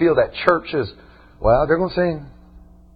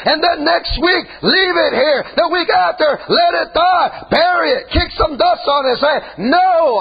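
full-force preaching; 11 semitones higher, slower than usual. Acted. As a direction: hammer every line home from the pulpit.

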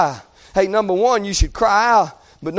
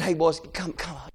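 soft, low mocking hush of the crowd; a bystander quieting them down; 6 semitones lower, 11 dB quieter than usual. The crowd hushing the two boys, lower and softer. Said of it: "the crowd around him said".

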